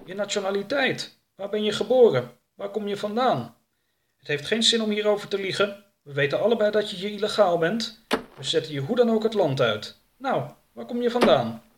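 high, insistent henchman voice; impatient interrogator. Acted. Jake to captive about his origin, insistent questioning.

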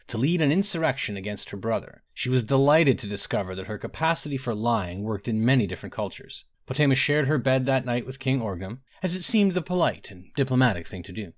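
usual speaking voice

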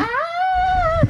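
high-pitched panicked scream. Pretend. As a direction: shriek in mock terror. Screams again.

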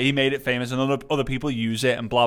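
ordinary delivery

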